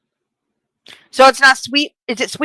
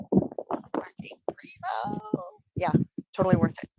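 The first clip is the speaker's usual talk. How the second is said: whispering; 9 semitones higher, 10 dB quieter than usual. Real, not acted. Aside praising the tuna.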